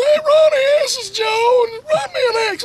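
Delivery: very high, loud, strained squeak; boss's high-pitched voice. Retold or imitated. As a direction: squeak it out like a breathless fat boss. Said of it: "Had a real high-pitched voice".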